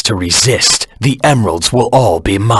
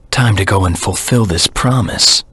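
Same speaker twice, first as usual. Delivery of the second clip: ordinary delivery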